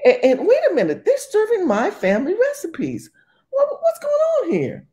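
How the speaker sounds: high falsetto caricature of a stunned woman; shocked owner. Retold or imitated. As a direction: play the clueless owner finding out, way up high. Owner discovers the takeover.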